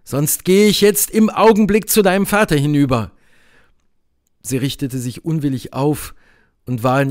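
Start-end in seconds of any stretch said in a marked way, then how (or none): 0.0-3.1 raised, louder threat; almost rough. A narrator's reading. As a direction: threaten her, voice pitched up. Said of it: "sagte ich fast rau". (fast raue, laute Drohung)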